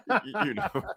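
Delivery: real laughter breaking through the words; laughing. Real, not acted. Laughing at own retold joke.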